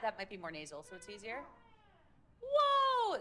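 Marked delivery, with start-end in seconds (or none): 2.4-3.2 very high falling whoa. Shown as a demonstration. Coach shows a harder high whoa. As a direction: slide down from way up. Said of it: "They're a little harder".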